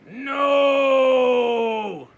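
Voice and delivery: loud, long, high ghostly no; eerie drawn-out no. Acted. The dark answering Laszlo with a long ghostly no. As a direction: moan the no like a ghost. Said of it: "said the dark".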